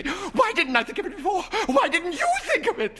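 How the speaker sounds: high, loud, laughing hysteria; hysterical laughing. A narrator's reading. Wife laughing hysterically at her husband. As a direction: laugh through the words, frantic. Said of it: "she said hysterically".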